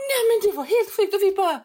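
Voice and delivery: high, loud, wound-up exclamation; high and loud with amazement; excited. Real, not acted. Excited retelling.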